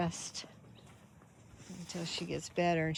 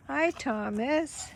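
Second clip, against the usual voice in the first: sing-song pet greeting; 7 semitones higher, 5 dB louder, slower than usual. Real, not acted. Greeting the cat sweetly.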